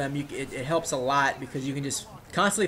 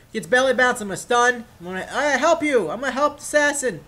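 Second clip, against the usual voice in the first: high, loud mock-heroic rush; pitched-up, hammed-up urgency; excited rescue call; 6 semitones higher, 5 dB louder than usual. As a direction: play up charging in to help a teammate. Calling out during play retold.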